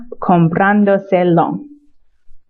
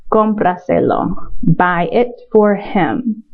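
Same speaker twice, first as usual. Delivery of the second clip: slow model command; slower than usual. Shown as a demonstration. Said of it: "model command slowly".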